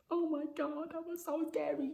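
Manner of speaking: high, small, softened squeal; squeaky mock fright. Woman reacting as creepy man's van passes, tiny squeaky fright. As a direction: squeak it under your breath, shaken for show.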